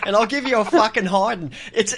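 ordinary talk

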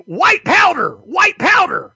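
high, loud street-corner chant; mock yelling. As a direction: yell it like a rallying cry. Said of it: "thought they were yelling".

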